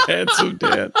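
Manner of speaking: high, laughing repeat of a silly name; helpless giggly delivery. Real, not acted. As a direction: say it while cracking up. Cracking up at the nickname.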